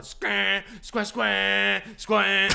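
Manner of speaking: loud squawking mock car horn; imitated car horn. Imitating an angry driver's horn, blaring. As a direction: honk like someone laying on the horn. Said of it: "starts fucking laying on his horn".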